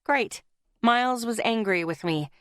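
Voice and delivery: ordinary delivery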